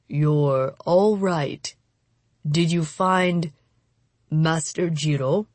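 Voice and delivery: slow, weary man's voice; slow, weakened relief; wounded samurai. A narrator's reading. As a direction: speak haltingly, as if badly hurt. Wounded samurai speaks weakly.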